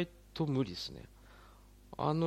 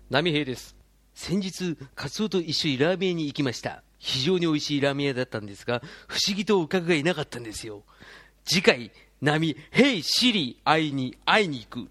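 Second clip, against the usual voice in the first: Namihei preview voice; 6 dB louder than usual. Shown as a demonstration. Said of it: "サザエさんの次回予告っぽく".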